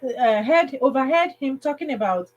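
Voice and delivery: ordinary talk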